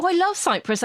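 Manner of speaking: high, gushing mock delight; a cheery gushing line. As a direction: gush about Cyprus brightly. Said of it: "I used to say to her".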